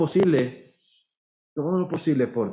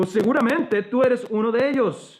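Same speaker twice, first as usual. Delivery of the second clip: suspicious bystander; 8 semitones higher than usual. Acted. Second accuser speaks.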